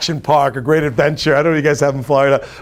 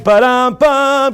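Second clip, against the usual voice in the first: drum sting; 8 semitones higher, much slower than usual. Pretend. Triumphant moment, drumroll sound.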